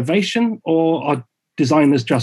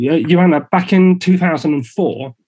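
ordinary delivery

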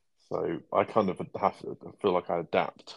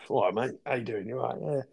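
higher-pitched British working-class pub greeting; slangy British pub accent; 4 semitones higher, faster than usual. Acted. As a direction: greet a mate in blue-collar British slang. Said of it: "very slangy, way of talking".

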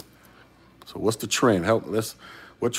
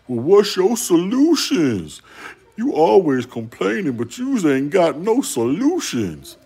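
much higher, louder, slow mock whine of a nagging critic; 10 semitones higher, 8 dB louder, slower than usual. Vlogger mimics viewers who call him a complainer, high loud mocking whine. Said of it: "and then when people be like".

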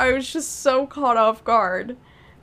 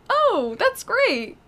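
higher, slow, mock-pleased "Oh, that's great"; a lightly hammed-up cheerful reply, higher and slow; mock delighted; 10 semitones higher than usual. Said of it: "she's like".

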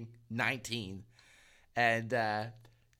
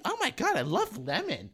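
imitated excited girl; 10 semitones higher than usual. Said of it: "Yeah, she's like".

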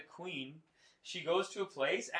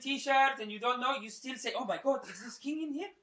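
high-pitched awed Iranian admirer; Iranian accent; 7 semitones higher, 4 dB louder than usual. Acted. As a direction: marvel at the king in an Iranian accent. Acted Iranian admirer praising the Shah.

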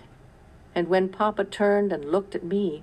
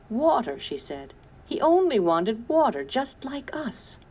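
high, louder tearful lament, fading at the end; crying; 8 semitones higher, 3 dB louder than usual. A narrator's reading. Sarah in tears over the thirsty coyote, lightly voiced crying. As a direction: let the grief break through on water. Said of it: "Tears streamed down Sarah's face".

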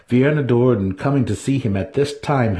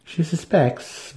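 growled; 9 semitones higher, much slower than usual. A narrator's reading. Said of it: "Matron Santa Fe growled".

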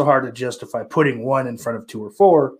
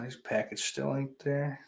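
ordinary talk

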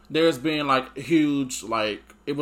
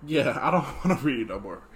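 putting on a brave face. Real, not acted. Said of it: "trying not to cry".

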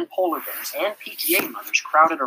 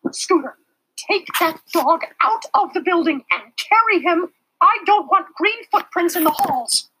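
high, loud sharp teacher's command; sharply; 6 dB louder than usual. A narrator's reading. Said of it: "said sharply".

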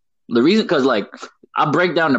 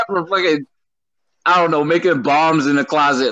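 usual speaking voice

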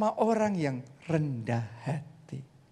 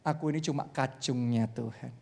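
humble; 7 semitones lower, 7 dB quieter than usual. Retold or imitated. Pak Obadja humbly answering his admirers, low and soft. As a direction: say it like a lowly servant. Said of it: "Tapi dia ngomong".

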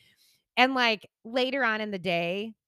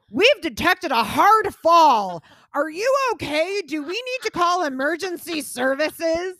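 mock device alert, higher, louder and slow; robotic watch voice; 8 semitones higher, 8 dB louder than usual. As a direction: imitate the smartwatch announcing a fall. Imitating Apple Watch voice.

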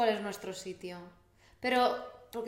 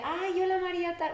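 retelling a greeting; 8 semitones higher than usual. Retold or imitated. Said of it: "Y yo te pregunté".

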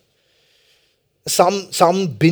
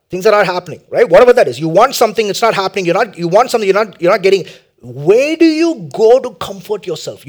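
loud preaching; 4 semitones higher, 10 dB louder than usual. Acted.

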